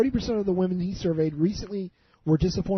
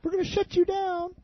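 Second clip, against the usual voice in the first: mock threat; 13 semitones higher than usual.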